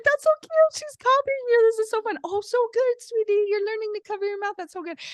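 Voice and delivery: put-on very high sing-song baby talk; cooing to baby. Parents cheering the baby copying her sister, high cooing. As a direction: coo praise like to a toddler. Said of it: "And we're like".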